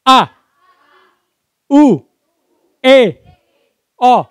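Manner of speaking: high, loud, separate vowel calls; big loud vowels. Shown as a demonstration. Trainer demonstrating articulation drill, loud exaggerated vowels. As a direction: belt out each vowel with a pause between. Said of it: "Yang, yang besar suaranya".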